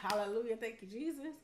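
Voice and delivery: ordinary talk, nothing marked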